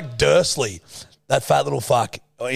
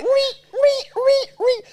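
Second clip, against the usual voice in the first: very high eerie squeal; much higher mock horror-film shriek; horror movie squeal; slower than usual. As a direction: imitate a creepy horror score. Horror movie sound imitation.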